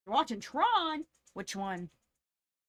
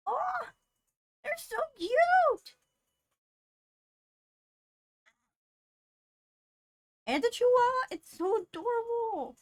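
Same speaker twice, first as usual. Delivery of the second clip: very high, gushing cuteness overload; adoring squeal; 12 semitones higher, 5 dB louder than usual. Real, not acted. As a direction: squeal with delight at adorable puppies. Gushing over cute dogs.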